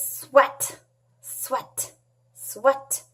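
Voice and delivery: light swishing sound, partly breathed; swatting noise. Pretend. Teacher imitating the horse swatting flies with its tail, light swishes. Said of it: "and the horse goes".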